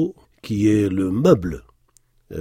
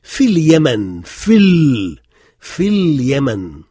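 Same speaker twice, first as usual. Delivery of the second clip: Fil Yemen linked as one unbroken phrase, slow and louder; no pause between words; 2 semitones higher, 6 dB louder, much slower than usual. Shown as a demonstration. Teacher shows students the linked phrase, slow and loud. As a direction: glide fil straight into Yemen with no gap, slowly. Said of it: "ne pas faire de pause entre les deux mots".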